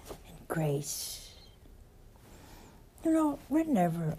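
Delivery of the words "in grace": softer whisper. Real, not acted. Preacher comforting the congregation, soft whisper. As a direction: whisper softly. Sermon line dropping to hush.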